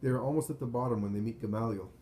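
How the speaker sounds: ordinary delivery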